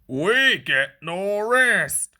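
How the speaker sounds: slow, grumbling engine voices; slow complaining chant. A narrator's reading. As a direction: drag it out like tired grumbling engines. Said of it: "they complained as they clanked".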